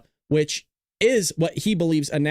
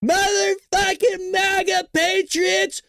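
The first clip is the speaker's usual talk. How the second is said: high, slow, drawn-out hype yell; hammed-up donor shout; 14 semitones higher, much slower than usual. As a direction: belt it like an announcer. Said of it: "and he goes".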